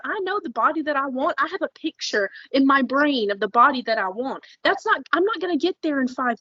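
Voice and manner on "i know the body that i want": ordinary talk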